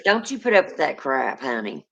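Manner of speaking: usual speaking voice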